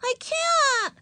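put-on extremely high, childish wail; crying out. A narrator's reading. Ramona rattling the bathroom door, crying out high. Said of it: "cried Ramona".

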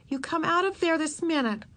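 high-pitched bossy command; scolding order. A narrator's reading. Big sister to hiding Ramona, scolding. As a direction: order the child out firmly.

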